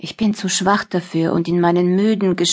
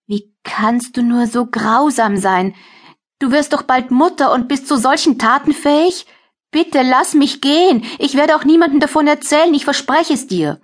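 desperate pleading; 6 semitones higher than usual. Acted. Captive begs to be freed.

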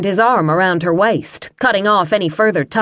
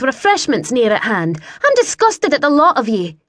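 high-pitched lady scolding the men; haughty censure building to disgust; indignant lady; 6 semitones higher than usual. Acted. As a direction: dress them down in an affronted, raised pitch.

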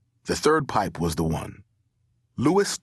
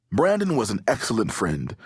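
usual speaking voice

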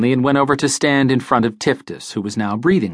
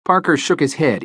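usual speaking voice

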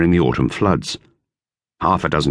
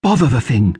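higher, lightly voiced girl; girl's voice, lifted higher; Dorothea; 5 semitones higher than usual. A narrator's reading. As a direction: read it as young Dorothea. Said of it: "she said".

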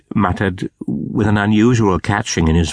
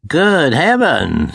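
higher, slow, awed exclamation; amazed exclamation; 7 semitones higher, 3 dB louder, slower than usual. A narrator's reading. Scrooge sees his childhood home, higher and slow in awe. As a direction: breathe it out in wonder, slowly. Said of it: "said Scrooge".

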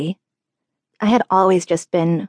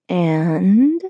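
unimpressed. A narrator's reading. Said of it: "Dad sounded unimpressed".

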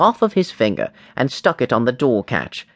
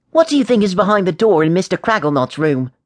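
lightly voiced higher-pitched boy; curious boy James; 5 semitones higher, faster than usual. A narrator's reading. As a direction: inquisitive schoolboy, pitched up.

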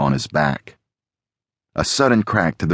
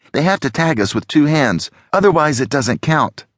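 high-pitched urgent explanation; voiced game partner; 9 semitones higher than usual. A narrator's reading. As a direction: explain the rule in a higher character voice. Alex explains game rules while fleeing.

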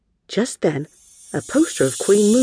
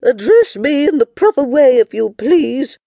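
haughty queen; 10 semitones higher, 8 dB louder than usual. Acted. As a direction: correct them like a haughty queen. Queen scolds the girls.

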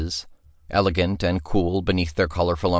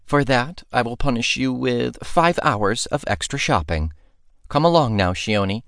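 high, haughty mock order; mock-regal command; 6 semitones higher than usual. Princess mock-punishing her friend, playfully haughty. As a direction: play the bossy princess in jest.

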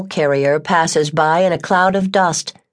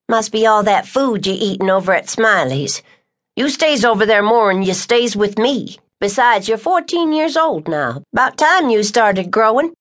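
higher, dry ribbing woman's voice; teasing Tucker; 4 semitones higher than usual. Acted. Tucker ribbing the boy about his height. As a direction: needle him about growing.

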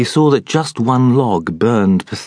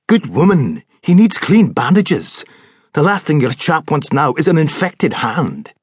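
higher, louder insistent; firm doctor; 7 semitones higher, 3 dB louder than usual. A narrator's reading. Doctor insists to the maid on clean bandages. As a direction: overrule her firmly but kindly.

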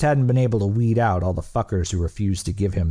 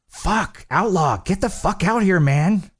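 high-pitched, exasperated call; impatient calling out; 10 semitones higher than usual. A narrator's reading. Impatient shout through the door.

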